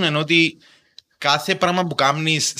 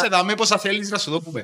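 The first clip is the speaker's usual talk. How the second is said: eager banker voice; 6 semitones higher than usual. Retold or imitated. Banker eagerly welcoming a government employee.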